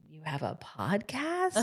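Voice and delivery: slow, drawn-out mock curiosity; stretched-out, hushed retold question; intrigued questioning. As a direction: imitate the moms, slowly and softly probing. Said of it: "question mark?".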